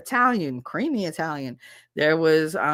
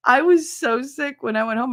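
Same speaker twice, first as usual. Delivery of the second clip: normal talking voice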